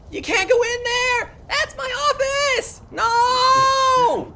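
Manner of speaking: very high, very loud frantic squeal; girly high-pitched kobold. Acted. Kobold running to keep strangers out of her office, shrill girly shouting. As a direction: shout it in a little girly screech, stretching the last cry. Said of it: "high-pitched voice".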